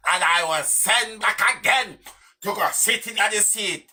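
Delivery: high-pitched mocking replay of an arrogant woman; mock arrogance. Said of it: "You hear the arrogance in her".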